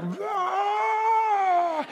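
high, drawn-out mock roar of a small bear cub; preacher voicing the baby bear's roar. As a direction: roar high like a little cub trying to be brave. Baby bear roaring in story.

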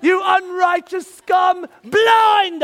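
loud, high, slow, hammed-up curse; over-the-top mock rebuke. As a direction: play the thundering prophet for a joke. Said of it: "I'm just gonna go like this".

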